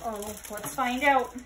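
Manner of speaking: ordinary delivery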